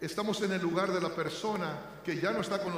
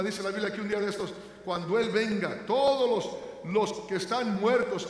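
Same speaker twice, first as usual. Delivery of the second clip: raised, louder preaching voice building to a cry; full-force preaching; 3 semitones higher, 4 dB louder than usual. Acted. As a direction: proclaim the resurrection to the congregation. Preaching on the resurrection. (predicador proclamando con fuerza la resurrección)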